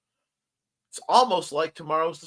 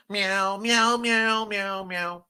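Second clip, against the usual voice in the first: playful sung meows, higher; meowing; 7 semitones higher, much slower than usual. Pretend. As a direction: meow like a cat to the cats. Imitating cat meows.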